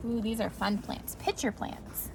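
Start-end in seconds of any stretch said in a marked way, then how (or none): none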